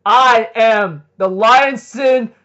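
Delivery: grand boastful proclamation. Retold or imitated. Escanor introduces himself to the demons, slow grand proclamation. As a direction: proclaim the title slowly and proudly.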